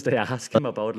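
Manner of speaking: ordinary talk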